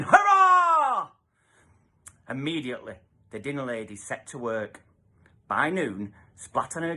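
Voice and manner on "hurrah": high, loud hurrah; cheer. Acted. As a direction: cheer with the whole kitchen. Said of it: "A cheer went up in the kitchen".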